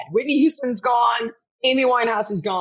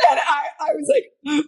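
ordinary talk, nothing marked

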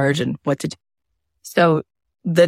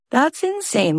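stunned disbelief; 8 semitones higher than usual. Retold or imitated. Disbelief at sales.

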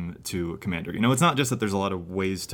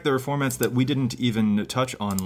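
normal talking voice

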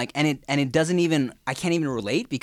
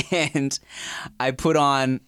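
ordinary delivery

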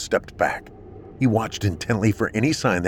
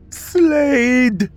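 screaming name; 6 dB louder than usual. A narrator's reading. Kat screams as trunk closes.

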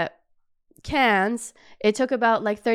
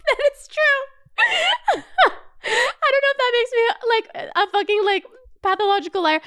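very high, laughing; giggling self-mocking high voice; 13 semitones higher than usual. Real, not acted. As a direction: squeal with laughter.

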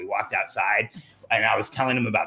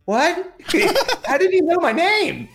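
much higher, laughing in disbelief; shocked; 6 dB louder, slower than usual. Retold or imitated. Asking Wayne Dyer how he knows his name, shocked and laughing. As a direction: squeal it out, amazed and laughing. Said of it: "And I'm like".

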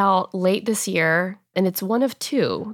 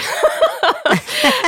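a bright laugh up high, falling away; 4 dB louder than usual. Real, not acted. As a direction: laugh at how it happened to rhyme. Laughing at rhyme.

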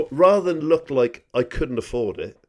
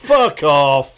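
shouted fuck off; 3 semitones higher, 11 dB louder than usual. Pretend. Acting out the urge.

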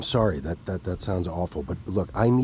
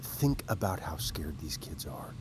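hushed; 6 semitones lower, 9 dB quieter than usual. Acted. Persuading quietly.

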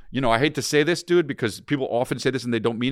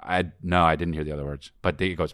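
ordinary talk, nothing marked

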